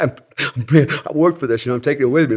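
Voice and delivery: a hint of stubborn pleading; retold protesting kid. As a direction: mildly mimic a kid refusing to let go. Child haggling over shark, retold.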